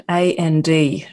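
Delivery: slowly spelling out A N D; spelled out. Shown as a demonstration. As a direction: spell each letter clearly and slowly. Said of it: "so everyone can hear my Australian accent and understand".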